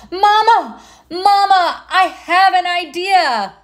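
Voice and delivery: high, loud excited call across the house. Acted. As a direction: shout for Mama with a sudden idea. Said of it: "and called out".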